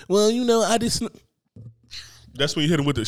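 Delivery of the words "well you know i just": mock excuses. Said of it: "be like".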